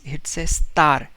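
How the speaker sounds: ordinary delivery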